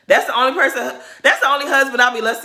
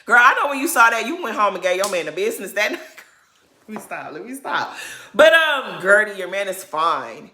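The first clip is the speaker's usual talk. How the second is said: ordinary talk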